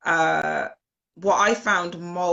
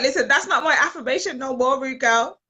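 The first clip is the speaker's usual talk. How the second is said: higher, louder playful retort; sassy comeback; 7 semitones higher, 5 dB louder than usual. Retold or imitated. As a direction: clap back teasingly at your friend. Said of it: "And I was like".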